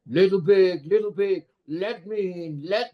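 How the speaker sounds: menacing big bad wolf calling; teacher voicing the wolf at the pig's door; evil wolf. Shown as a demonstration. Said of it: "make it sound as evil as possible".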